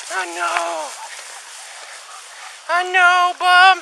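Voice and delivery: high, sing-song praise for a good dog; fond high-pitched praise for the dog; doting dog praise. Real, not acted. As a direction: gush over him like a proud owner. Handler cuddling and praising dog.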